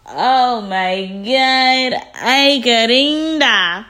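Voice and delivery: high, loud, drawn-out exclamation; gleeful shock. Real, not acted. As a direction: stretch every word in scandalized amazement. Her shocked reaction to the insult.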